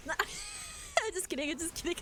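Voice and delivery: laughing. Real, not acted. Teasing a raider.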